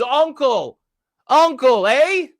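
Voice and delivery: high, loud mock plea of a beaten man; mock crying uncle. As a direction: squeal out uncle like he's begging for mercy. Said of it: "until he says".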